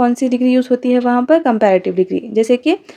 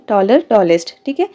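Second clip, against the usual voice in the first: normal talking voice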